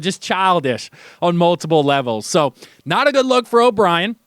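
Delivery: ordinary talk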